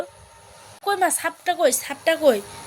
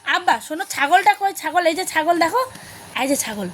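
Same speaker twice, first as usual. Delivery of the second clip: put-on high, lilting picture-book voice for a toddler; sing-song reading to a child; 5 semitones higher than usual. Mother to toddler over a picture book, high sing-song coaxing. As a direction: coax the little one to name pictures, sing-song.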